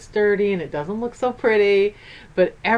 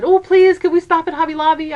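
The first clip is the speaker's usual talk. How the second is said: pleading; 7 semitones higher, 4 dB louder than usual. Retold or imitated. Begging to stop at store.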